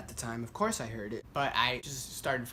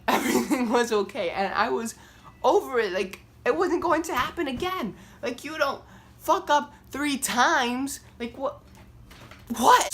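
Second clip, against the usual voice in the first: much higher, louder disbelief; incredulous outburst; 13 semitones higher, 9 dB louder than usual. Real, not acted. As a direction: climb into outraged disbelief. Retelling her rising disbelief.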